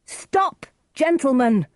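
high, urgent shout; crying out. A narrator's reading. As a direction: call out loudly to halt a fight. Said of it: "Romeo cried".

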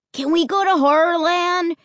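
put-on high-pitched pleading kid; eager boy. A narrator's reading. Boy asks parents about the park, eager. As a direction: ask like an excited little boy. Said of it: "Luke asked".

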